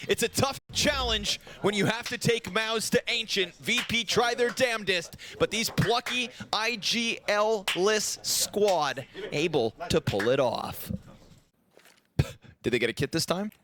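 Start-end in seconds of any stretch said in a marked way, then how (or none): 0.1-10.9 raised, driving esports commentary; excited play-by-play. Acted. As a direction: build the round to its final call. Live play-by-play to match end.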